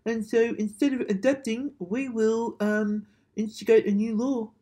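mocking TV network. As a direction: mimic a money-hungry broadcaster mockingly. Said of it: "They're like".

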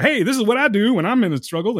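a hint of an otherworldly helper; mythical creature. Reviewer quoting the show's mythical creature, lightly voiced. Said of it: "mythical creature that comes in and gives her".

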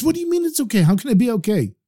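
high-pitched protest; upset disbelief. A narrator's reading. Farmer protesting to the rabbi, upset disbelief. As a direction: object in high, incredulous distress.

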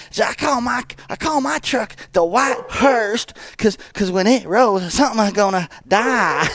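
hammed-up high hillbilly impersonation; Crazy Bobby. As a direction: imitate a cartoonish country oddball, way up high, drawing out the last words. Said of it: "And he's go".

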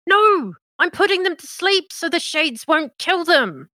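high, loud protesting cry; indignant shout. Acted. Accused woman shouts her defence at the party, high and loud. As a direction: yell a desperate denial.

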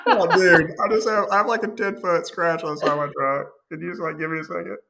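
a touch of mock desperation; exasperated plea. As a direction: beg the kid for a second, half laughing. Pleading with the kid in line.

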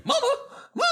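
very high, squeaky little chicken crying for mama. Acted. A lost chicken calling for its mama, squeaky and high. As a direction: pitch way up like a tiny frantic chick.

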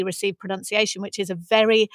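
ordinary talk

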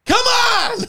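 mock shout from a viewer at home; impatient shout. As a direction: yell at the screen impatiently. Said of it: "these people at home that are like".

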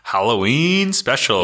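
drawn-out, playful Halloween announcer; spooky announcement. Pretend. Cohost echoing the Halloween special intro, spooky and drawn out. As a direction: stretch it out with mock-eerie relish.

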